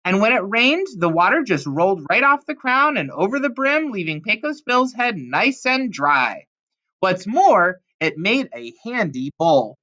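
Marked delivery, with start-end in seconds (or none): none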